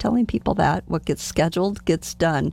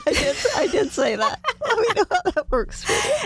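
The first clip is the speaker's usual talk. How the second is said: high-pitched, laughing admission; amused, squeaky-high laughing repeat; 13 semitones higher than usual. Real, not acted. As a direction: laugh through the words, voice climbing high. Laughing at own quoted remark.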